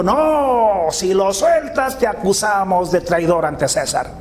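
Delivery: high, drawn-out "no", easing down by the end; imitated crowd shout. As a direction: mimic a protesting crowd without full volume. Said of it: "el pueblo otra vez gritó". (grito imitado de la multitud, agudo y alargado)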